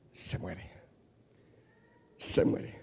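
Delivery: dropped to a near whisper; hushed. Acted. Pastor warning the church, hushed. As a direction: let it die away to a breath.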